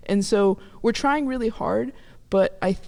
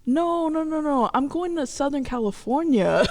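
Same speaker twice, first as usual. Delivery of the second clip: higher-pitched, insistent fib; mock innocent denial; 7 semitones higher than usual. Daughter fooling mom about where she's headed, insistently innocent. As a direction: deny it lightly, re-enacting the phone call.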